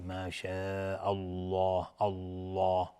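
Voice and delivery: الله with a dark L, lips gathered like a bud; slow ما شاء الله, thickening the vowel in الله; rounded heavy Allah. Shown as a demonstration. Teacher showing mouth shape, الله said heavy with rounded lips. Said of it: "باید دهان جمع بشه مثل غنچه".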